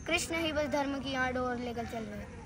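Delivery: soft verse lead-in; hushed recital. Acted. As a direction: recite it quietly, held back. Soft first take of verse. (धीमे स्वर में कविता)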